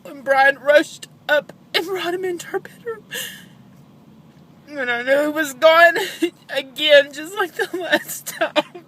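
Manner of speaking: sobbing, high and strained; snotty crying. Real, not acted. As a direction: voice cracking with grief. Recalling finding her son.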